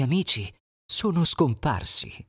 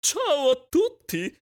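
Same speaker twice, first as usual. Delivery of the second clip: much higher, genteel goose. Acted. Goose greeting passing animals, higher voice.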